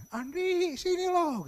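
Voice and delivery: imitated impatient boss shout; boss summoning. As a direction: call the employee over sharply from afar. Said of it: "yang manggil sama".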